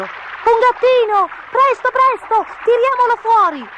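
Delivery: put-on very high, loud urgent kids; excited high-pitched children's cries; children shouting. A narrator's reading.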